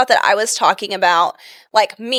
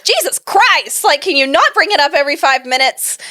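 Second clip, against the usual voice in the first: higher-pitched retold exasperation; exasperated; 5 semitones higher than usual. Mother retells her reply to her tactless daughter, exasperated. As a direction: plead at the kid, fed up.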